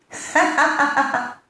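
high, staccato stage laugh; storyteller's hearty ha-ha; acted laugh. A narrator's reading. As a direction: laugh off a threat in a high run. Mediator laughs off the threat.

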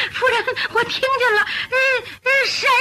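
high, tremulous, alarmed voice; frightened. Acted. Maid and mistress hear someone calling outside, frightened. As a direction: stammer it out high, spooked.

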